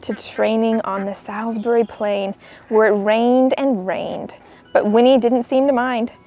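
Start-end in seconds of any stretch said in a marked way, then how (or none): none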